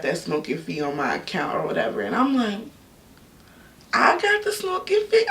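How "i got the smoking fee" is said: high, laughing fake sob over the fee; mock crying. Telling viewers about the unfair smoking fee, mock crying. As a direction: whine it through a laugh and a sob.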